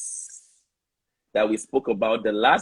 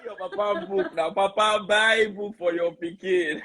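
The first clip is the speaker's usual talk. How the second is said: amused pidgin joking; 7 semitones higher than usual. Real, not acted. Joking in pidgin amid laughter.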